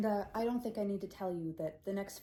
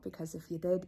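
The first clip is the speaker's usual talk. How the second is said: usual speaking voice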